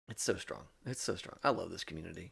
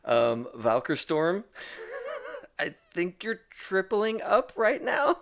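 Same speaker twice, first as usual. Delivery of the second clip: slow, high, breaking into delighted laughter; thrilled laughter spilling through the words; laughing with joy; 11 semitones higher, 6 dB louder, slower than usual. Real, not acted. Host thanks a donor for tripling up, laughing with joy.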